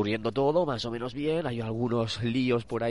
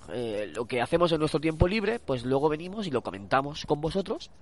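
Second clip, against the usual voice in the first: ordinary talk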